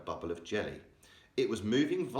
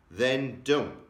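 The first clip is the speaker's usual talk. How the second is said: sharply; 5 semitones higher, 7 dB louder than usual. A narrator's reading. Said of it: "answered sharply".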